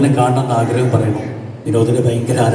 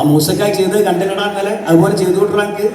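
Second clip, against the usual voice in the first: put-on higher, eager old mother's question; imitating old mother; 7 semitones higher than usual. Old mother asking her son about Moosa, in a higher, lightly imitated eager voice.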